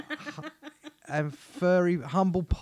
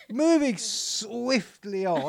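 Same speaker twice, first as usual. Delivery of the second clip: slow, higher-pitched jokey transition; drawn-out segue; 4 semitones higher, much slower than usual. Acted. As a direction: stretch the words out to change the subject. Jokey change of subject.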